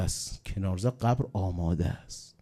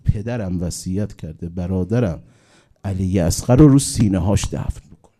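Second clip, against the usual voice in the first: louder grieving plea; 8 dB louder than usual. Acted. Imam voiced, grieving command.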